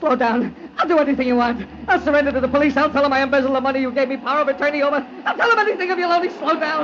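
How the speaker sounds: high, fast frantic begging; desperate pleading. Acted. Man bargaining with the ghost driver, desperate. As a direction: beg for your life.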